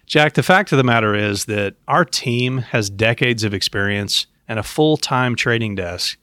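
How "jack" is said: normal talking voice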